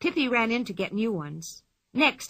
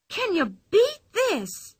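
put-on high, slow girlish cry of discovery; a child's high voice, amazed at her find; Pippi crying out; 11 semitones higher, 4 dB louder, slower than usual. A narrator's reading. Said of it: "she cried suddenly".

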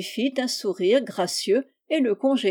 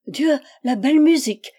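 louder, higher cry of wonder; delighted exclamation; 4 semitones higher, 6 dB louder than usual. A narrator's reading. Princess on her balcony hearing the blackbird, delighted cry. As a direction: gasp out your delight at the music. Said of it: "s'écria-t-elle".

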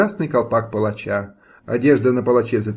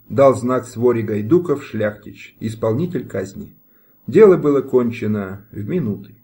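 usual speaking voice

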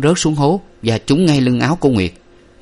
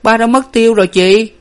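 high, louder worried question; worried little sibling, pitched up; anxious child; 13 semitones higher, 6 dB louder than usual. A narrator's reading. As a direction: ask anxiously for your missing father. Frightened child asks missing father.

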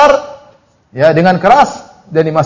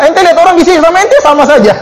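higher teasing voice; raised taunting tone; mocking jeer; 9 semitones higher than usual. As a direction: needle them with a jeer.

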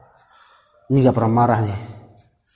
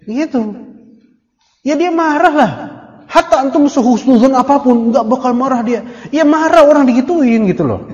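heated rebuke; 8 semitones higher, 4 dB louder than usual. Real, not acted. Preacher rebukes the audience's naive husnuzon.

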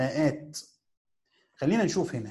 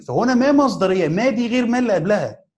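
emphatic point; 6 semitones higher, 8 dB louder than usual. Real, not acted. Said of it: "teacher stresses the point".

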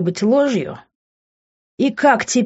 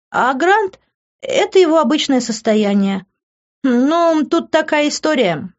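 high, light female character voice; girl fibbing coolly; 6 semitones higher than usual. A narrator's reading. Girl tells parents tall tales, calmly fibbing. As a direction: explain it glibly, pitched well up. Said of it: "девушка спокойно рассказывала эти небылицы".

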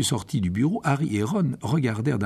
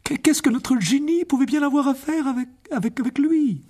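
high, stammering distress; a frantic mother stumbling over words; distraught mother; 12 semitones higher than usual. Acted. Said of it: "dit madame Weasley". (mère affolée, voix haute qui bégaie)

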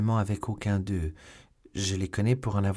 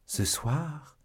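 surprised question; 7 semitones higher, 3 dB quieter than usual. A narrator's reading. Milady startled by the news.

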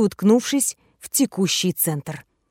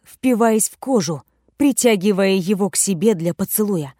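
plain reading voice

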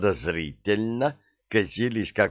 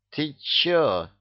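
put-on drunk hiccupping question; пьяно, с иканием; 6 semitones higher than usual. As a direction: hiccup it out tipsy. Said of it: "пьяно икнула Розочка". (пьяный иканием голос)

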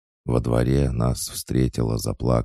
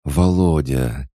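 tearful cry; 4 semitones higher, 5 dB louder, slower than usual. Acted. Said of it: "тихонько заплакала".

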